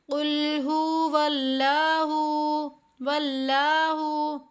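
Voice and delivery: वल्लाहु with the ला drawn long, light लाम; long la in Allah. Shown as a demonstration. Teacher showing students the stretched ला, careful recitation. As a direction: stretch the ला in वल्लाहु, lam kept thin. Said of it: "यहाँ पर अक्सर गलती क्या होती है".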